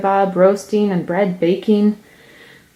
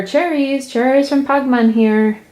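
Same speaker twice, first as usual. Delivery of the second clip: higher, louder vendor's shout; 6 semitones higher than usual. A narrator's reading. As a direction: push the call out harder. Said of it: "He tries shouting louder".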